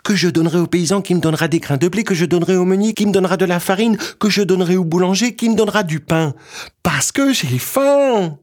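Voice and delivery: put-on high, fast child's breathless chain; small boy voice. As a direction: rattle off the list like a little boy. Petit bonhomme asks the earth.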